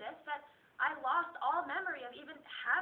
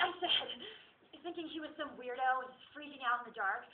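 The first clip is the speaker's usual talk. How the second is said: normal talking voice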